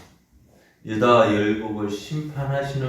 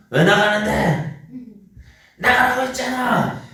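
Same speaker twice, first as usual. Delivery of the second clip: shrill mock quarrel; 11 semitones higher, 8 dB louder than usual. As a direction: play both sides of the fight, half laughing. Parent and child quarrel acted. (웃으며 흉내 내는 말다툼 고함)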